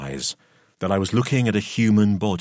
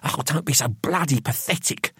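pitched-up, exasperated scolding; tense contempt; scornful rebuke; 6 semitones higher than usual. A narrator's reading. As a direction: snub him with impatience. Gregory scolds sobbing Roy.

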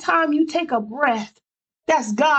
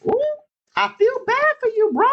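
mock sympathy; 11 semitones higher than usual. Preacher mocking pity for Job, voice pitched high and playful. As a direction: coo it with exaggerated pity. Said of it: "And I'ma say it like that".

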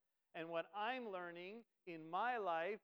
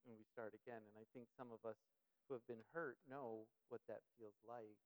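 ordinary talk

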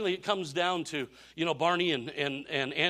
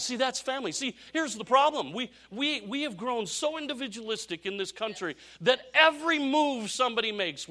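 high, emphatic sermon delivery; raised, insistent preacher voice; impassioned preaching; 8 semitones higher, 4 dB louder than usual. Real, not acted. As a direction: press the problem on the congregation. Preaches the problem at full force.

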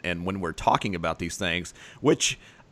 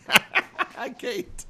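laughing greeting. Real, not acted. Greeting kids who walked in.

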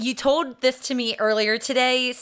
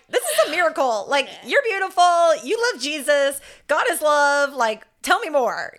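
higher-pitched imitation of an amazed admirer; awed delight; 5 semitones higher, 3 dB louder than usual. Village woman meets a smiling visitor, awed and gushing. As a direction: gush in wonder, pitch lifted.

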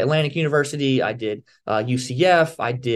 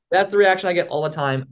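ordinary delivery

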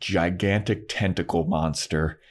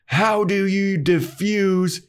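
tense question; 11 semitones higher, slower than usual. Retold or imitated. Xander's tense key question.

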